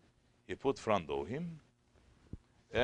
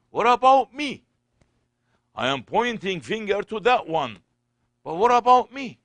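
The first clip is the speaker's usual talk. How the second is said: high, loud impassioned outcry; urgent self-questioning; 13 semitones higher, 14 dB louder than usual. Acted. Preacher voicing self-doubt to the congregation, high and impassioned. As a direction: cry it out, turning the accusation on yourself.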